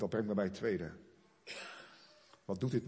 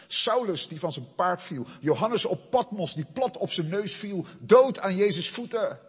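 high and loud roll call; preaching at full force; 8 semitones higher, 10 dB louder than usual. Real, not acted. Preacher cries out the saints who fell before God. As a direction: call out each name like a fanfare.

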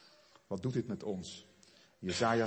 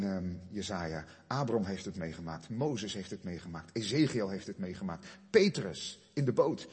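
usual speaking voice

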